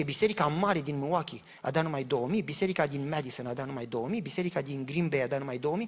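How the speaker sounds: ordinary delivery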